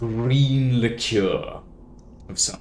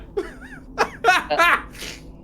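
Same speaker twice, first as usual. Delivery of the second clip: laugh. Acted. Barmaid laughs at short patron.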